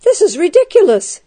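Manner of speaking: exasperated outburst, pitched high; exploding. A narrator's reading. Guard explodes at the prisoner's excuse, high and exasperated. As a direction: burst out in indignant disbelief. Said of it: "he exploded".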